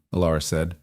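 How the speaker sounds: ordinary delivery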